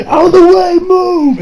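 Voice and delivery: high, loud imitated shout; urgent call-out. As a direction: clear a crowd as you run. Voicing men running through mall.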